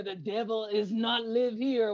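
slow, hammed-up Jamaican accent; a mock Jamaican accent line; bad Jamaican accent. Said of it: "the worst Jamaican accent I ever heard".

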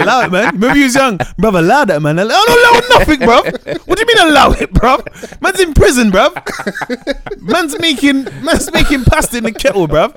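high, loud protest breaking into laughs; outraged but cracking up; laughing mock protest. Acted prison quarrel, laughing.

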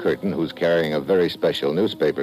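tough agent narrating over music; hard-boiled narration. Acted. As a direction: set up the story, world-weary.

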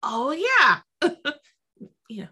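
usual speaking voice

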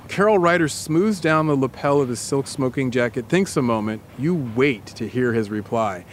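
lightly voiced guided-scene narration, calm and even; story narration. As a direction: paint the scene for her, unhurried. Narrates immersive scene to co-host.

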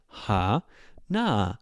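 two separate steps, "Ha" down, "na" up; low then high. Shown as a demonstration. Teacher repeats the example, "Ha" low, "na" high. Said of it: "lower, taller".

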